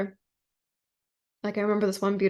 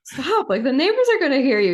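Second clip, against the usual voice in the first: loud and higher, hushing him in alarm; raised, pitched-up alarmed plea; urgent protest; 7 semitones higher, 8 dB louder than usual. Retold or imitated. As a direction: beg him to stop before the neighbors hear. Urging partner to stop yelling.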